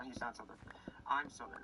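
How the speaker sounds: ordinary talk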